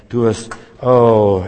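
awed sigh. Pretend. Awe at seeing Jesus.

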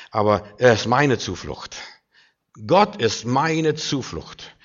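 ordinary talk

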